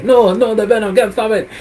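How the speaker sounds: hammed-up villain losing control; panicked Bane. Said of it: "and Bane's like".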